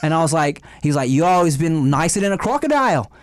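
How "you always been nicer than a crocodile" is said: broad, hammed-up Aussie caricature; mock Australian accent. Promoter complimenting a comedian in a mock Australian accent. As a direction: lay on a cartoonish Australian accent. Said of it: "That's a weird accent you're doing".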